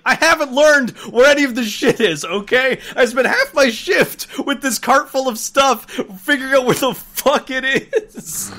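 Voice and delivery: loud, high exasperated outburst; exasperated rant. Retold or imitated. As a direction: vent loudly, fed up. Retold rant at customer.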